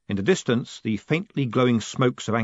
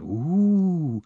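a long rising crowd ooh; 7 semitones higher than usual. A narrator's reading. Narrator voicing the crowd's impressed ooh.

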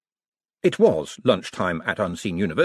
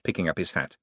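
usual speaking voice

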